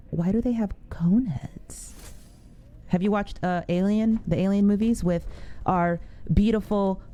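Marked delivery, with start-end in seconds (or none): 1.3-1.9 whisper. Real, not acted. Whispering at creepy android.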